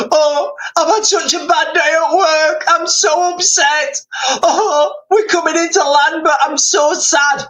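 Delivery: high-pitched, hammed-up weepy voice; mock crying over the plane's intercom; tearful pilot. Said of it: "on the intercom, and he's like".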